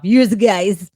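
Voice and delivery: "Use guys" in a rough attempt at a Jersey accent. Shown as a demonstration. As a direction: try the Jersey accent on "Use guys", not quite landing it. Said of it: "I can't pull that off".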